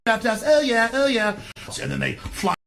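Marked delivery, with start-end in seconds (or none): 0.1-1.4 high, smug hipster voice, pitched up; prissy trendy hipster cooing over craft beer; sneering parody of a smug hipster; mock hipster.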